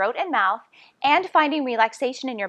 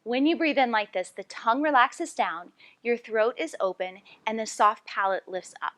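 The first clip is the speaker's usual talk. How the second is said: usual speaking voice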